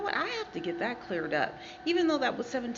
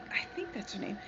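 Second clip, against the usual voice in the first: whisper; 9 dB quieter, faster than usual. Real, not acted. Unsure aside about name.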